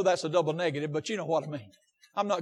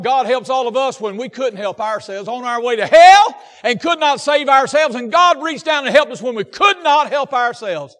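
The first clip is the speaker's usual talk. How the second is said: loud, raised, driving sermon voice; pressing each phrase on the congregation; impassioned preaching; 5 semitones higher, 11 dB louder than usual. Real, not acted. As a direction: preach it with force. Preaches at full force.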